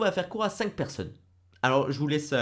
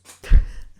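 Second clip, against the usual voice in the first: burst of laughter; 11 dB louder than usual. Real, not acted. Laughing at his own switch.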